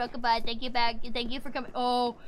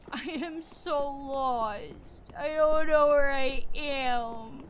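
slow, low, defeated mumble; dejected moan; 4 semitones lower, much slower than usual. Real, not acted. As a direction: drag the words out, lost and deflated. Lost, dejected after wailing.

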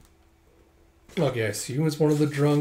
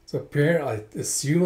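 usual speaking voice

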